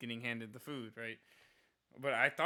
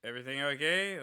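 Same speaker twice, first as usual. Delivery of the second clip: mock concerned check-in; 7 dB louder than usual. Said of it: "I'm like".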